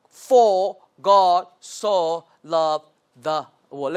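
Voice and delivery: very slow, each word separated; one word per clap. Shown as a demonstration. Teacher showing clapping rhythm, one word each. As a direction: space every word out on a beat. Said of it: "clap once, each word".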